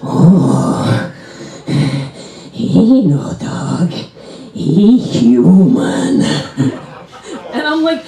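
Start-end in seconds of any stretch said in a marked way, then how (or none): 0.0-6.2 very slow, drawn-out creepy mutterer; eerie, lingering broken-English voice; creepy Korean lady. Acted. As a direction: stretch each word out like a menacing stranger. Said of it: "she's like".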